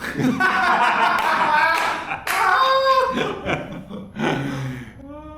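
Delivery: loud, high burst of real laughter; big laugh. Real, not acted. Guest cracking up at his own payday joke, loud laugh.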